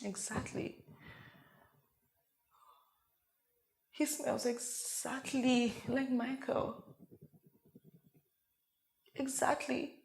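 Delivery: very soft, slow murmur; hushed realization. Acted. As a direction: let it sink in, barely voiced. Dwelling on his smell.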